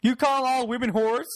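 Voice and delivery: high mock outraged objector; flipping out. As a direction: imitate a scandalized critic demanding an answer. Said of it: "and people flip out".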